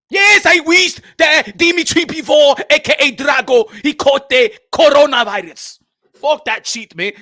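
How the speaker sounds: put-on loud African-accented boxer's curse; slow, shouted ill wish on a rival; Makabu.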